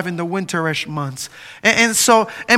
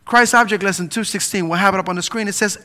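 ordinary delivery

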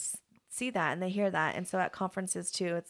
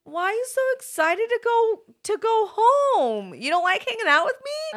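mock hurt; 8 dB louder than usual. Teacher teasing her kids for wanting to leave, mock hurt. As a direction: play wounded for laughs.

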